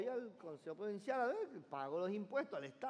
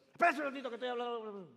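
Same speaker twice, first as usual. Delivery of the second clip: quick, brushing off the guard, a little louder; rude phone caller; 4 semitones higher, 5 dB louder, faster than usual. Retold or imitated. Man on phone in bank waves off the guard, rude. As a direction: shoo him away mid-call.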